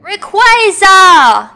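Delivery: long, high, loud drawn-out roar of the name; summoning shout. Acted. Summoning the dragon, a hammed-up drawn-out bellow. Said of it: "That was such a bad impersonation".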